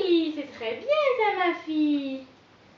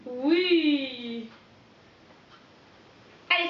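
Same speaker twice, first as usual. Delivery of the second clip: usual speaking voice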